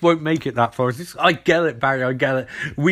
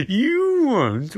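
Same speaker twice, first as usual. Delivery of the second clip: mock incredulous; 7 semitones higher than usual. Acts Barry's doubting reply.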